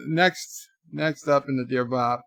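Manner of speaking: normal talking voice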